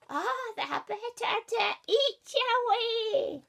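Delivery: high, loud, slow triumphant wolf; spring the trap with a gleeful roar; wolf revealing himself to the girl, loud and high; wolf pouncing. Acted. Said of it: "said the wolf".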